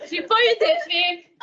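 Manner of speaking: rueful laugh while speaking. Real, not acted. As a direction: laugh through the confession. Laughing admission.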